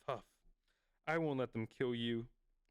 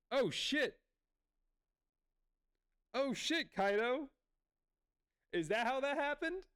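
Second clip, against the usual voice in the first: much higher, stunned oh shit; shocked excitement; 11 semitones higher than usual. Real, not acted. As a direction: blurt it out in real disbelief. Shocked by anime reveal.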